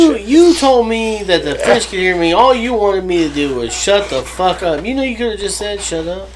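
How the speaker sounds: mock angry phone call. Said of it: "that's when you pick up the phone".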